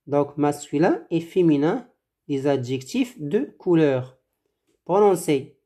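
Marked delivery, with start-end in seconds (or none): none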